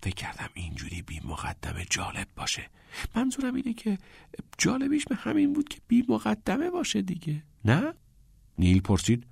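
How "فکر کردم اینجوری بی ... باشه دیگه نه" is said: whispered explanation; hushed, confiding whisper; quiet murmur. A narrator's reading. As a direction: murmur it under your breath, justifying yourself. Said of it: "چارلی آرام زمزمه کرد".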